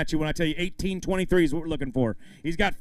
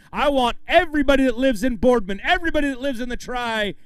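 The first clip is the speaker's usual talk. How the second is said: higher, louder crowd call; rallying shout; 6 semitones higher, 4 dB louder than usual. Real, not acted. Emcee urging the whole town to come, rallying. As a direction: pump up the crowd, voice raised.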